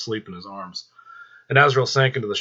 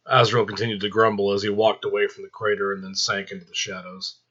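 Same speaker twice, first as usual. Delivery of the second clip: ordinary delivery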